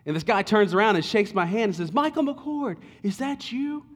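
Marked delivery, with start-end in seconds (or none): 1.9-3.8 high, bright mock surprise; retold high "is that you?"; surprised greeting. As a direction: imitate a stranger spotting an old friend. Stranger greets him, surprised.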